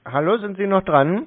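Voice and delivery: loud call back to the caller; calling into the phone. Real, not acted. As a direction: raise the voice to get them back. (lauter Ruf ins Telefon)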